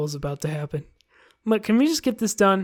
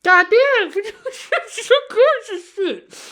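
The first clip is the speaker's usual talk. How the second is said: much higher, laughing through words; laughing hard; 6 dB louder, slower than usual. Real, not acted. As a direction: cackle with delight. Streamer laughing at scene.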